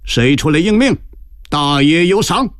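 high-pitched rallying call; calling to crowd. A narrator's reading. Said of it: "转脸向人群喊道".

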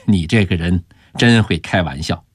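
ordinary delivery, nothing marked

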